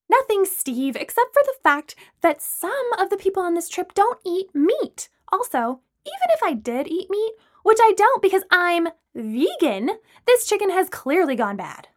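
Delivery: high-pitched, exasperated argument; worked-up objection to the food; indignant retort. A narrator's reading. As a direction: argue back in a high, heated voice. Acted quarrel over dinner.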